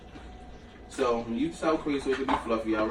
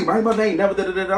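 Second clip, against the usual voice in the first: mocking Corinne; 6 semitones higher, 4 dB louder, faster than usual. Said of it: "now she's mad 'cause she's wrong".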